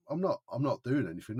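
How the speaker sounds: loud, indignant insistence; loud denial. Retold or imitated. Shouting his innocence at the accusing security guard. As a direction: snap back that you've done nothing.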